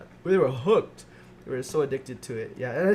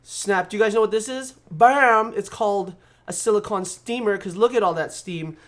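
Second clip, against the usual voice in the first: excited reveal; 5 semitones higher, 8 dB louder than usual. Real, not acted.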